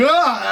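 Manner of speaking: high, loud retold scream; shocked scream. Wrestler alone after getting signed, acted freak-out scream. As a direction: let out an overwhelmed high scream.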